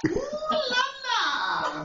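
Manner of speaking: high, slow, stretched ooh la la; hammed-up French flourish; mock French ooh la la. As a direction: trill it out like a posh lady.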